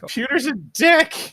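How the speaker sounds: high, loud, drawn-out outburst; exasperated retort. Acted. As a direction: stretch it out in high-pitched indignation. Acted exasperated retort to viewers.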